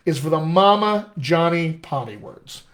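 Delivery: ordinary talk, nothing marked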